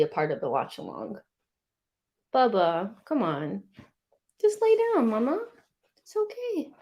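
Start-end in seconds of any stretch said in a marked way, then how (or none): 2.3-6.7 high, gentle coaxing; soothing pet talk. Real, not acted. Owner coaxing the pet to lie down, high and soothing.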